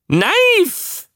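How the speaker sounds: very high, yelped creature cry; animal bark. A narrator's reading. Beast barking at the man as it drops something, high bark. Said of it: "bellte das Tier".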